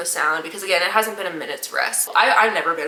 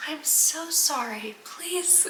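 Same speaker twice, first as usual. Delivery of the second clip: soft, high, slow whimper; imitating the girl's sobbing plea; mock whimpering plea; 6 semitones higher, 10 dB quieter, much slower than usual. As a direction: beg faintly like she's broken. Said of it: "between screaming and being like".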